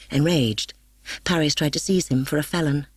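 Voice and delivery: ordinary delivery, nothing marked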